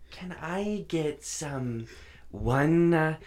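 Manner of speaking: lightly voiced, slow Southern mom ordering; a hint of a hesitant mom fumbling the order; mimicking mom. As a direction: mom stumbling over a foreign word, slowly. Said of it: "she can't even say latte".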